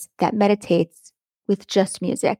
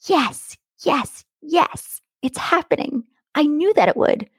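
excited; 6 semitones higher than usual. Shown as a demonstration. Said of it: "let me give you an example".

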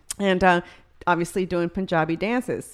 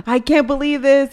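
amazed disbelief; 7 semitones higher, 7 dB louder than usual. Retold or imitated. Driver's amazement retold.

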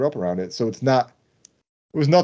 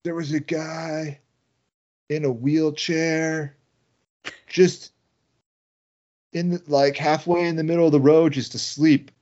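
retold coworkers; 6 semitones higher, 3 dB louder than usual. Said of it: "My coworkers were like".

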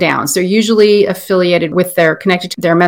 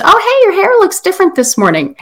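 breezy mom; 11 semitones higher than usual. Retold or imitated. And the mom says.

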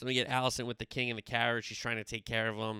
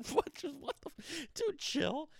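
high, incredulous outburst; high-pitched what-the reaction; shocked disbelief; 4 dB quieter, slower than usual. Real, not acted. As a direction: squeak out your disbelief at the scene. Reacting to the queen's dagger order.

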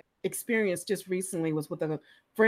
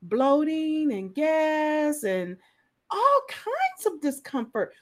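mildly voiced friend, drawn out slowly; a slow list of complaints, lightly acted; a touch of weary complaining; imitating a friend; 8 semitones higher, slower than usual. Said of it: "She said".

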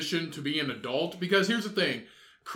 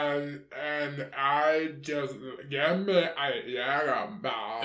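put-on basic girl voice; 3 semitones lower, slower than usual. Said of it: "Every, every basic girl voice".

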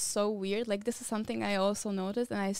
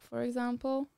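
ordinary talk

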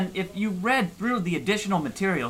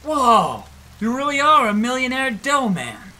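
awed; 7 semitones higher, 5 dB louder than usual. Acted. Awed by the millionaire.

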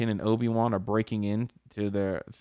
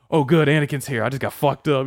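louder, higher mock relief; voicing the Emperor; 3 semitones higher, 5 dB louder than usual. Emperor greeting Anakin mid-fight, mock relieved. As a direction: play a rattled Emperor greeting his rescuer. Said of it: "he's like".

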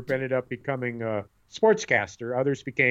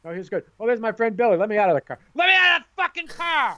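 mock whiny teen; 8 semitones higher than usual. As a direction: play the embarrassed kid who builds from pleading to a screamed demand. Mocking a teen in car.

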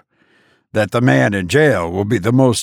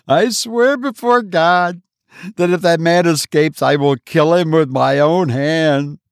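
vengeful oath; 6 semitones higher than usual. A narrator's reading. Wife swears revenge before her brother, fierce and high. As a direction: swear it like a sacred threat. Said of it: "suddenly turning upon her brother".

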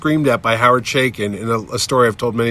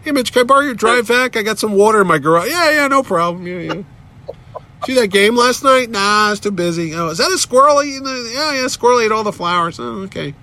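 much higher, mock neighbourly voices; everyday neighbour chat; 9 semitones higher than usual. Jerry asks neighbour to borrow a drive vac, mock folksy. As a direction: play banal small talk in a raised voice.

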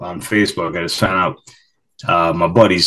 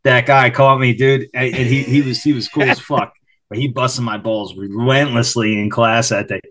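ordinary delivery

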